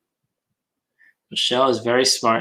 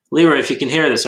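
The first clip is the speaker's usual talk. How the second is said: louder, higher call to someone offline; 4 semitones higher, 9 dB louder, faster than usual. Real, not acted. Host to dropped-out Leroy, calling out. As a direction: call out in case he can hear.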